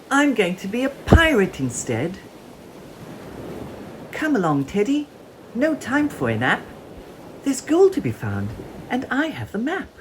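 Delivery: put-on high, loud eager child voice; a little girl playing pirate, thrilled; squeaky child calling out her adventure; excited little pirate. A narrator's reading.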